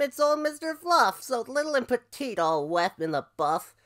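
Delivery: high, exclaiming ram voice; ram crying out. Acted. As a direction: call everyone to look, as an amazed ram. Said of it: "cried the Ram".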